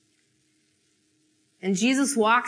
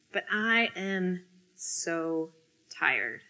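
weary; 3 semitones lower, 9 dB quieter, slower than usual. Acted. Confessing exhaustion.

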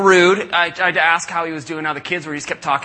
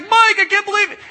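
much higher, excited squeal; thrilled disbelief; 5 dB louder than usual. Retold or imitated. Wife squealing happily at Mike on the phone. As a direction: gush in happy disbelief.

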